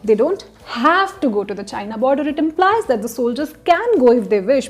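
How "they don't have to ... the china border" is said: "have" stretched and stressed; strong single beat on "have". Shown as a demonstration. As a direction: lean hard on "have", then run through the rest. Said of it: "changing the emphasis on different words".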